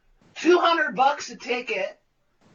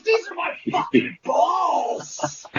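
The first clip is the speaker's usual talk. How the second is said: higher, slow, emphatic; intense director; 6 semitones higher than usual. Retold or imitated. Director holding the actor's hand, slow and emphatic. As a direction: spell it out slowly for effect. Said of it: "He goes".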